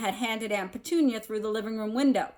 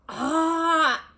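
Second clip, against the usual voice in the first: loud, high startled cry; startled yelp; 8 semitones higher, 7 dB louder than usual. A narrator's reading. Harry treads on his uncle's face, startled yelp. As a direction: jump at the surprise. Harry steps on something squishy.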